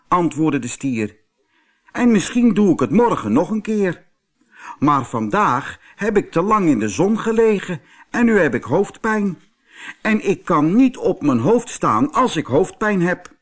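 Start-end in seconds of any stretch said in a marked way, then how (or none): 1.9-13.4 high, loud, quick bluffing bull; excuse-making bull. Acted. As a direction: talk your way out as the proud bull.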